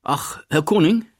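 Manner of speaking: calling out. A narrator's reading. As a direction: call after him across the corridor. Wehrmann calls after Martin. (ruft ihm laut hinterher)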